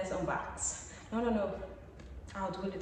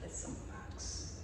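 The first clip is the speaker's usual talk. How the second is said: slow whispered remark; hushed slow aside; 13 dB quieter, slower than usual. Real, not acted. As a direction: murmur it slowly to yourself. Looking at the box.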